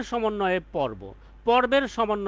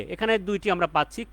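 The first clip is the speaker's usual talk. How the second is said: usual speaking voice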